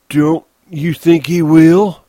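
high, slow, loud pleading question; anxious Lennie. Acted. Lennie asks Crooks whether George will return, anxious. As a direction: ask it slowly and high, needing reassurance.

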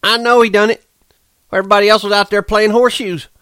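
high, loud, fast rant; Curly accusing the big man, high and furious. A narrator's reading. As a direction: spit it out in a rage. Curly raging over the body.

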